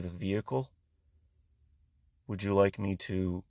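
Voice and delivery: mock the uptight desk guy, softly; prim appraiser. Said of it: "he looks at me and he's like".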